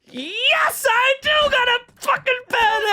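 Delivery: high, exultant cry; mock-ecstatic squeal of agreement; overjoyed outburst. Retold overjoyed answer on phone.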